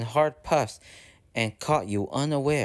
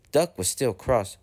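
usual speaking voice

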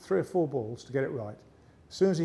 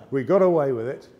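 triumphant punchline; 10 dB louder than usual. Real, not acted.

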